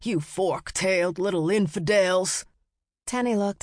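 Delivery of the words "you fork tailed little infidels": put-on mild growling insult at boys; understated cranky holler; hollering merchant. A narrator's reading. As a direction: scold the boys as little devils. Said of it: "hollered at the boys".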